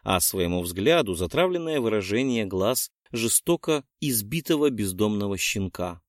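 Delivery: ordinary delivery, nothing marked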